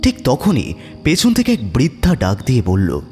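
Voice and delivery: usual speaking voice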